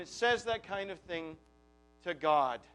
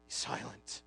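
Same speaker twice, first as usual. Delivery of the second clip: a hushed, breathy be silent; whispered command; 11 semitones lower, 14 dB quieter than usual. Shown as a demonstration. The gospel hushing the groaning heart, whispered. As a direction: hush it like calming a child.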